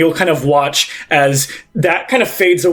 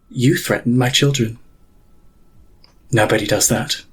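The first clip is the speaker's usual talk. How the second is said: menacing pontiff; 4 semitones lower than usual. Acted. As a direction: speak low and deadly, like a mother guarding her brood. Pontiff voiced by GM.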